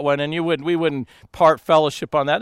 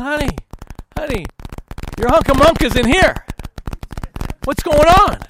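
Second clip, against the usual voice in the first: high mock whine, building louder; pleading complaint; 8 semitones higher, 4 dB louder than usual. Husband calling wife back to bed, high mock pleading. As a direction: call out, hammed-up and put out.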